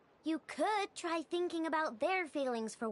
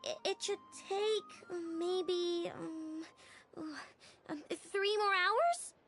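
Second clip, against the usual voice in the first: flustered hesitation. Acted. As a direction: stall and stumble towards an uncertain answer. Stalling, reluctantly estimating repair time.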